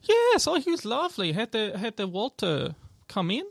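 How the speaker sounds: delighted, high elf shopkeeper; elf woman. Acted. Elf alchemist remembers lovely Walter, high and delighted. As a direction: gush in an accented voice, starting very high. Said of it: "elf woman come out".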